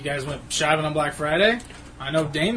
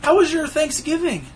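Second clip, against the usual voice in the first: high excited voice; 12 semitones higher, slower than usual. Acted. Carries on in high voice.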